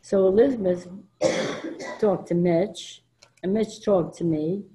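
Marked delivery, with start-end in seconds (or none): none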